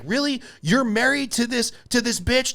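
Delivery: high, disbelieving retold rant; mock shocked jab at the heckler; incredulous outburst. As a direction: scoff at her husband in mock outrage. Bill Burr going off at heckler.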